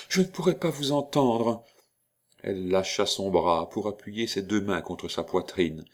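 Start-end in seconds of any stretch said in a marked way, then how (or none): 0.1-1.6 higher, anxious plea to stop; agitated interruption. A narrator's reading. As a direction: break in with a raised voice. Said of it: "l'interrompit-elle". (interruption agitée)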